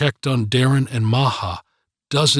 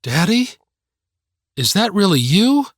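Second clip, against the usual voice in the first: high, stunned questioning; shocked disbelief; 8 semitones higher than usual. A narrator's reading. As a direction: ask as if seeing a ghost. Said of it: "Maha was shocked".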